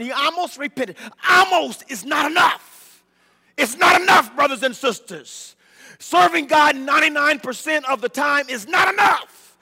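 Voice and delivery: loud, high, driving preacher cry; full-force preaching. Acted. Preacher shouting to brothers and sisters, full force.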